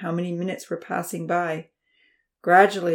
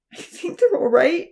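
high, louder tearful voice; crying; 11 semitones higher, 6 dB louder than usual. A narrator's reading. Uncle reassuring through tears, crying. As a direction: speak through welling tears. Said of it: "he cried".

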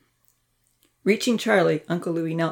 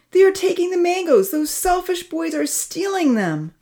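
high-pitched, indignant cry; loud alarmed call; 12 semitones higher, 3 dB louder than usual. A narrator's reading. Amy alerts the other girls to the theft, calling loudly. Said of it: "she called loudly to the other girls".

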